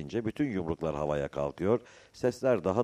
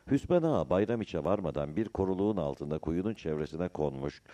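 ordinary delivery

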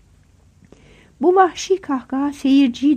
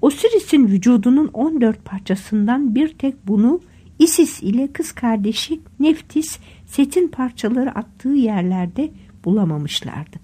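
usual speaking voice

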